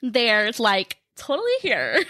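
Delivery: put-on playful Valley Girl take on a creepy line; exaggerated Valley Girl lilt. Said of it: "That's my Valley Girl impression".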